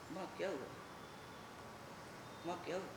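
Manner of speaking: small, cautious question; timid asking. Acted. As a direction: ask gently, barely audible. Son asks his frightened mother.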